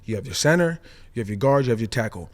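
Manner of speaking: usual speaking voice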